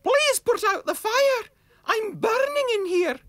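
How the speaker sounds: very high, loud squeaky pleading shout; little voice shouting. Acted. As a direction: shriek it like a tiny animal on fire. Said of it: "the little voice shouted".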